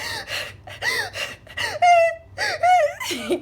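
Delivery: high, loud hysterical wail; imitated loud crying. Showing viewers how she cried in the mall, hammed-up sobbing. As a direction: wail in gasping high squeals. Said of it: "I'm literally like".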